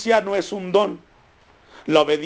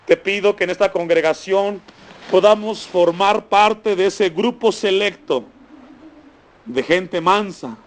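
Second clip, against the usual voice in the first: oración en voz alta, más aguda y sostenida; oración pastoral; 3 semitones higher than usual. Real, not acted. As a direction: ora con fervor, frase por frase, con pausas. Ora en voz alta por la congregación. (oración ferviente por la congregación)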